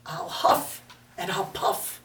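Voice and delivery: whispered wolf threat; sneaky whispered wolf; menacing whisper. Acted. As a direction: breathe the threat out softly like a wolf.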